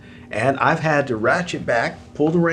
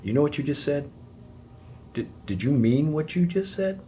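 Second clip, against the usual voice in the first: softer, calm and measured; hushed check-in; 6 dB quieter than usual. Retold or imitated. Spouse halting a hurtful remark, softly. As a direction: gently pause the fight. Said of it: "whereby we say".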